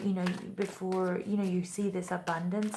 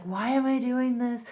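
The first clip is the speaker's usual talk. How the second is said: raised, louder fed-up complaint; exasperated whine; 5 semitones higher, 6 dB louder than usual. Retold or imitated. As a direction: voice a tired, frustrated viewer. Exasperated viewer voiced.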